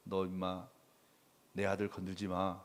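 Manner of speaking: low, soft, menacing warning voice; God scolding a bully. Pretend. Pastor voicing God warning a tormentor, low menacing growl. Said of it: "하나님이 나타나서".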